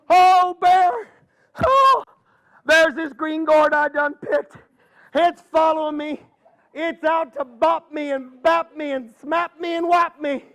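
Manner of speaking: a terrified granny begging Bear for help; panicked old lady. Acted.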